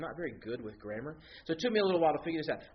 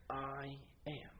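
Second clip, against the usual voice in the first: usual speaking voice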